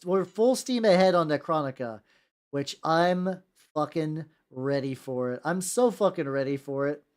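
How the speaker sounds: usual speaking voice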